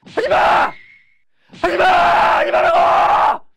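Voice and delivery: drawn-out, all-out scream; huge shout. Acted. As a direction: throw caution away and scream it at them. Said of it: "소리를 막 크게 질렀어요".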